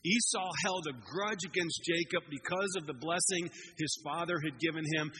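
normal talking voice

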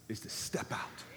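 quiet, breathy aside; whispered point. Real, not acted. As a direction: drop to a whisper for emphasis. Sermon point dropped to whisper.

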